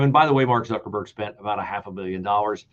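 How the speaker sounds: ordinary delivery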